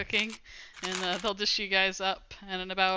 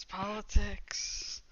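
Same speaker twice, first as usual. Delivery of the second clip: drawn-out, softer dismissal; shrugging resignation; 7 dB quieter, much slower than usual. Acted. Gang member excusing the new boss's orders, shrugging it off. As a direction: let it trail out slowly, like a shrug. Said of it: "he kinda shrugs and goes".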